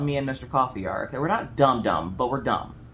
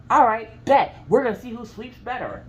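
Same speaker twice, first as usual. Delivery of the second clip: fired-up challenge; 8 semitones higher, 4 dB louder than usual. Retold or imitated. Accepting husband's sweeping challenge.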